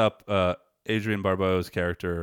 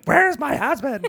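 high, louder, drawn-out mock wail; imitated drunk yell; 6 dB louder, slower than usual. Host imitating drunk friend searching the bar, mock yell. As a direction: holler it as a drunken friend would. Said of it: "You were just like".

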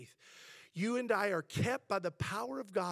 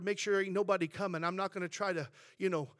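normal talking voice

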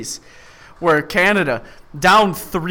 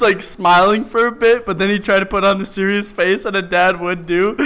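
usual speaking voice

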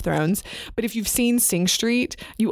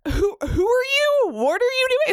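mock scared Scrooge. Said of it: "He's like".

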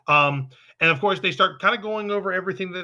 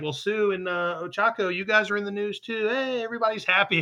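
excited classmates' chatter; 6 semitones higher than usual. Retold or imitated. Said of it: "and then it's like".

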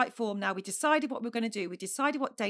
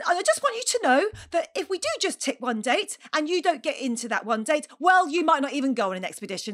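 louder, higher, tight and exasperated; angry lecture; 4 semitones higher, 6 dB louder than usual. Retold or imitated. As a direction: scold him with barely held temper. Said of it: "horrific hormonal outburst".

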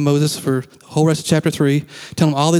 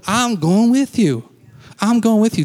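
God's promise; 6 semitones higher, slower than usual. Acted. God promising Moses, voiced.

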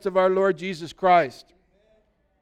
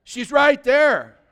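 loud, slow, higher, every word hammered; emphatic punchline; 6 semitones higher, 6 dB louder, much slower than usual. Real, not acted. Preacher driving home the point to the congregation, loud and slow. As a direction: land it hard and slow.

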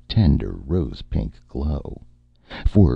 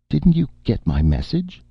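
higher, insistent demand; 12 semitones higher than usual. Acted. Erica demanding why her agent-client got no message. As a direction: press him for an answer. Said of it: "Erica demanded".

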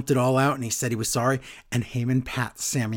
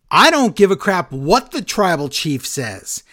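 loud, higher mock outburst; losing it; 5 semitones higher, 7 dB louder than usual. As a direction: imitate a wrestler blowing up. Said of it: "Bad Uso loses it".

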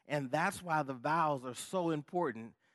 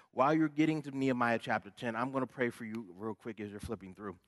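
normal talking voice

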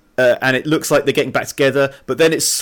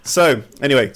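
normal talking voice

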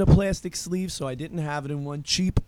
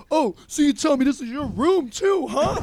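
higher, taunting mock challenge; mock a drunk pushing the argument further; imitating belligerent drunk; 8 semitones higher, 4 dB louder than usual. Drunk friend challenges whose room it is, mock belligerent. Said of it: "Zach is belligerent drunk".

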